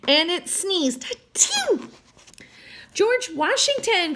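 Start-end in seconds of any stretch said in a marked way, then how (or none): 1.1-1.9 tiny high squeaky sneeze; hamster sneeze. A narrator's reading. Narrator voicing the hamster's tiny sneeze. 2.9-4.1 higher happy call of the name; joyful cry. A narrator's reading. Sam finding his hamster, crying out higher. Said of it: "cried Sam".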